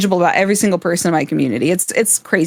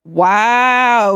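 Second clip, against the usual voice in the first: ordinary talk, nothing marked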